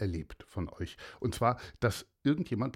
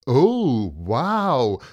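mock wonder; 9 dB louder than usual. Bully mocks girl.

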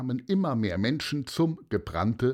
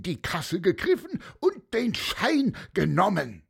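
high, scoffing accuser with a mocking laugh; insistent, high-pitched denunciation; blustering candyfloss seller, jeering; 9 semitones higher than usual. A narrator's reading. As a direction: whip up the crowd against the boy. Seller stirs up crowd.

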